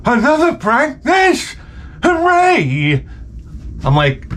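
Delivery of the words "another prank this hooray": high squealing delight; mock wife squeal. Comic mimics his wife cheering the coach. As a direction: squeal it like a giddy fan.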